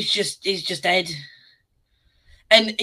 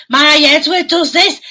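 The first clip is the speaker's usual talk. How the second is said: loud, high, drawn-out Scouse; over-the-top slow Scouse accent; 7 semitones higher, 7 dB louder than usual. Retold or imitated. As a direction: put on a hyper Scouse voice, pitched right up. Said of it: "in this Scouse accent".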